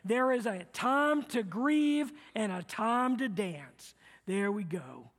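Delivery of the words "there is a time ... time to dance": slow unison reading of the verse; slow call to recite together; slow recitation. Shown as a demonstration. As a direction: lead the congregation through it steadily. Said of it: "Let's say it together".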